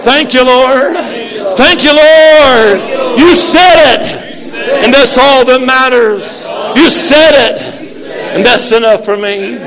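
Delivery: building from plain to a high loud cry; shouted praise. Shown as a demonstration. Preacher leading congregation in thanks, building to a shout. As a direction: lead the praise, rising each time. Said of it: "Raise your hands up high and say".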